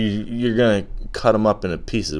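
normal talking voice

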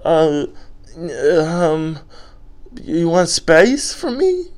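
high, halting, hammed-up hurt question; mock panic. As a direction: imitate a panicked boyfriend, voice way up. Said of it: "you just panicked".

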